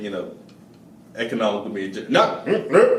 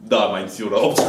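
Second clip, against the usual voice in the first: low, deadpan Russian agent; Russian accent; 7 semitones lower than usual. Retold or imitated. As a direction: answer like a loyal sleeper agent. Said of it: "she's gonna be like".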